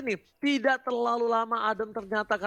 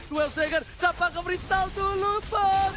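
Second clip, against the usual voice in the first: excited call; 6 semitones higher than usual. Real, not acted. Caster calls kill.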